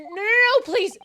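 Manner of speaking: high, loud pleading shout; desperate plea. Acted. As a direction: beg loudly for them to stop. Acted desperate plea in court.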